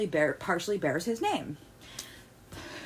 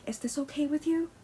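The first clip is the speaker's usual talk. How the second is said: higher, softer gentle question; you held softly at the end; mock polite ask; 7 semitones higher, 4 dB quieter than usual. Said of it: "really ask them".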